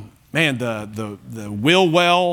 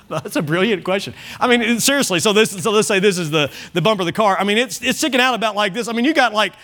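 ordinary talk, nothing marked

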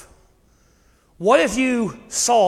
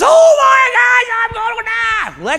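hammed-up shriek of a drama queen, very high, loud and slow; drama queen wail; 7 dB louder, slower than usual. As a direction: overplay a splinter like a fatal wound, in a shrill mock shout. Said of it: "drama queen".

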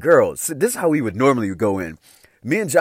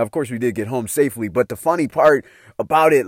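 normal talking voice

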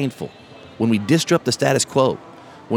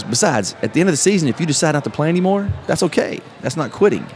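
dad's reassurance; 6 semitones higher than usual. Retold or imitated. Dad's lesson to the boy.

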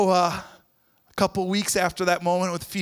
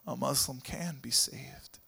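tearful whisper; 9 semitones lower, 11 dB quieter than usual. Retold or imitated. Tearful Philo agrees.

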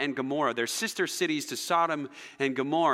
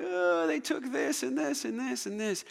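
put-on high-pitched whine of a crying child; mock crying; 9 semitones higher than usual. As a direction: imitate a tearful kid complaining to dad, much higher. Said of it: "I'm just crying".

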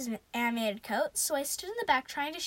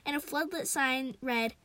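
ordinary delivery, nothing marked

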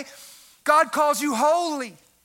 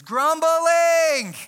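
ordinary talk, nothing marked

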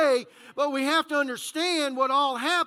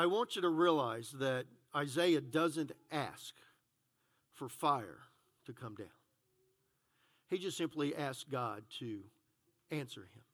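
much softer and lower, leaning in; hushed; 11 semitones lower, 10 dB quieter than usual. Real, not acted. Preacher to congregation, hushed and low. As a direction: bring the voice right down to a hush.

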